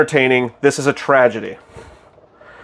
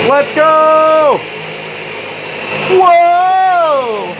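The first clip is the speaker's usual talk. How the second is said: cheering; 11 semitones higher, much slower than usual. Real, not acted. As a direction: celebrate at full voice. Cheering the blender.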